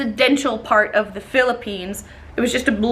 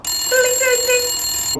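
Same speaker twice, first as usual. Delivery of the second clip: much higher singsong ding; mock ringtone; 12 semitones higher, much slower than usual. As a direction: chime it like a phone. Imitating phone ringtone.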